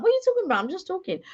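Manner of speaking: high mock bafflement; retold high-pitched protest; confused protest. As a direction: act out baffled innocence, starting very high. Retold confused reply to shouting complaint.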